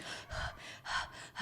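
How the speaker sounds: imitated soft breathless gasps; mock winded breaths; out-of-breath panting. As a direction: pant as if just after running. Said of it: "out of breath".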